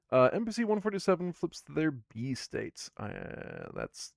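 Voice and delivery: normal talking voice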